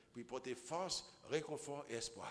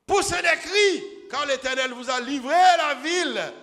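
shouted much higher and louder; Joshua's battle cry; 12 semitones higher, 19 dB louder than usual. Acted. Joshua shouting his order to the people, a full roar. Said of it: "Josué dit au peuple".

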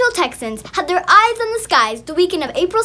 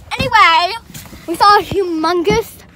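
usual speaking voice

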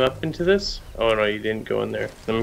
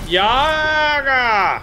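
excited shout; 12 semitones higher, 5 dB louder than usual. Real, not acted. Shouting the operator name.

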